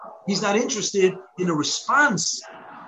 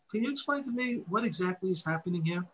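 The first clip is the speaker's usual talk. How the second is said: softer, genuinely curious asking; polite inquiry; 8 dB quieter than usual. Shown as a demonstration. Teacher showing the respectful way to ask, gently. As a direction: ask sincerely, wanting the answer. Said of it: "You can say the same words".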